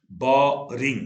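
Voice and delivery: बोरिंग loud, parted as बो, रिंग; syllable split. Shown as a demonstration. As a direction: stretch and punch बो, then add रिंग. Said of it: "दो syllable".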